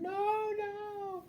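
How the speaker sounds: very high shouted no; shouting. Retold or imitated. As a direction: cry it out high. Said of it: "he's shouting".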